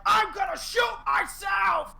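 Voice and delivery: high, slow imitation shriek; stretched-out, high mimicked scream; emphatic scream. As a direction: scream it out in over-the-top announcer hysterics. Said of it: "emphatic screams that he does".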